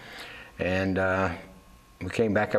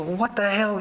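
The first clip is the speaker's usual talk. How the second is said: high, exasperated "what the hell"; retold disbelief; 10 semitones higher than usual. Father to son who changed his story, high disbelief. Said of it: "I said".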